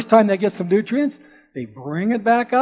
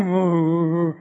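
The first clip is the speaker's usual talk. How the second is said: mock cow moo; 2 semitones lower, slower than usual. Boy counting cattle on the hill, mooing like the cows.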